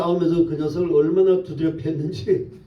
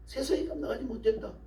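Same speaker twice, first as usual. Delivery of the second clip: high, much softer echo; hushed repetition; 8 semitones higher, 11 dB quieter, faster than usual. Real, not acted. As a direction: drop the volume right down, keeping the pitch up. Hushed repeat of the declaration.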